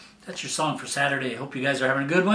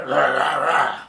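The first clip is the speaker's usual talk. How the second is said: frog croak; 6 dB louder than usual. Retold or imitated. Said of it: "like a frog".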